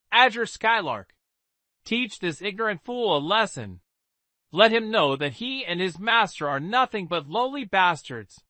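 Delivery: put-on haughty young noblewoman ordering; arrogant command. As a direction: look down on them as you order. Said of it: "raised her head arrogantly".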